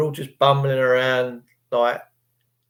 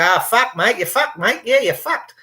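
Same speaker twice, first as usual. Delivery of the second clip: a hammed-up Australian accent, pitched higher; mock Aussie accent; 6 semitones higher than usual. Mocking how Australians talk, in a high mock Aussie accent. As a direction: bounce it up like a cheeky Aussie bloke. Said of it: "The Aussies go".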